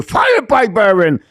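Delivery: sudden high, loud burst; excited shot call. Real, not acted. Commentator calling a sudden shot on net, excited shout. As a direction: jump up into a shout as the shot comes.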